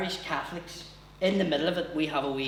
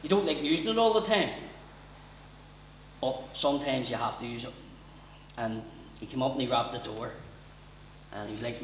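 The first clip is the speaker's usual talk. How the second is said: ordinary talk, nothing marked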